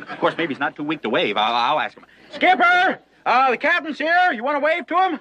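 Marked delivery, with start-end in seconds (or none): 2.3-3.0 loud high call into the tent. Acted. Calling into the tent. 3.2-5.2 calling out. Acted. Calling into the tent.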